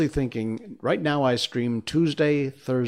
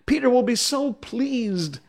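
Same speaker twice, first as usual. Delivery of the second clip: very high, eager squeal; excited high-pitched urging; screaming fairy; 13 semitones higher than usual. Acted. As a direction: goad him in a high fairy voice. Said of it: "she screamed".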